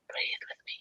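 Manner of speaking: breathy whisper, very soft. Retold or imitated. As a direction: whisper it so they must listen hard. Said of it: "And I'm whispering at them".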